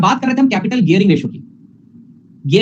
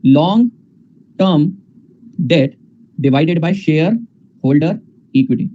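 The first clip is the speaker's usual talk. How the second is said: dictated slowly; much slower than usual. Shown as a demonstration. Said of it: "तो ऊपर क्या आ जाएगा बेटा?".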